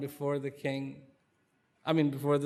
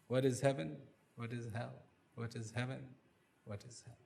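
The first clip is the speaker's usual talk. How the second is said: fading murmur; 3 semitones lower, 9 dB quieter than usual. Acted. King's repeated question while marching.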